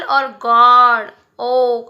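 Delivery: गॉड ओ said very slowly with a long open o; stretched vowel. Shown as a demonstration. Teacher drawing out the o in गॉड ओ. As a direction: hold the o in गॉड ओ. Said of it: "ओ का आ रहा है".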